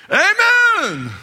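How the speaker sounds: high, loud enthusiastic amen; loud inner amen. Retold or imitated. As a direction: cheer it out high and strong. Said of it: "at the top of her mental voice".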